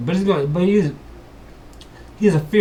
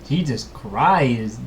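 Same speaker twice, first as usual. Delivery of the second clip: normal talking voice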